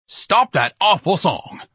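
high, loud, drawn-out shout. Acted. Ignatius ordering the choir to stop singing, shouting. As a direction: shout each word slowly. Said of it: "Ignatius shouted at the choir".

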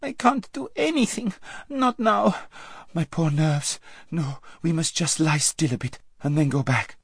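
put-on high, fretful girlish voice; squeaky panic. A narrator's reading. Lazareline refusing to go on, squeaky and fretful. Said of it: "squeaked Lazareline".

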